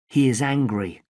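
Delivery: usual speaking voice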